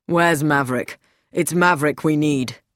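low-pitched, testy demand; irritable. A narrator's reading. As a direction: ask testily in a deep voice. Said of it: "Lewis Sericold said irritably".

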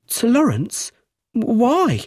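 high sharp questions; sudden alarm. A narrator's reading. Widow to inspector, high and alarmed.

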